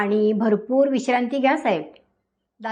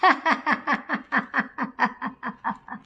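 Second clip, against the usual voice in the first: dreadful laugh; 4 dB quieter than usual. Acted. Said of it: "Ganesh भीषण हसू लागला".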